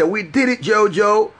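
the real happy-news version; excited catchphrase. Shown as a demonstration. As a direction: sing out the catchphrase high. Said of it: "If you hear me say".

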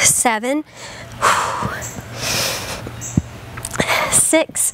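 whispering. Real, not acted. Whispered counts mid exercise.

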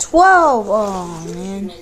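disappointed sigh. Real, not acted. Failed flip, groaning.

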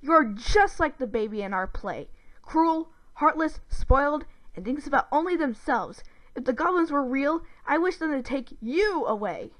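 loud, high-pitched accusing insults; angry rant. A narrator's reading. Brother at breaking point berating his sister, angry. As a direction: punch out each insult, fed up. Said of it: "just really angry".

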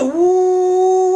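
high, loud woo of alarm; shocked whoop. Retold or imitated. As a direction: let out a startled yelp at the sight. Shocked exclamation at big dog.

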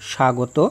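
স্বাগত said as shagoto, with no b; স্বাগত starting with a plain sha; silent ব-ফলা. Shown as a demonstration. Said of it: "কোনো উচ্চারণ হয় না".

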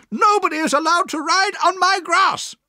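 outraged shout at high pitch; furious aunt, very high and loud. A narrator's reading. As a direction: lay down the rule at a high yell. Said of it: "she shouted".

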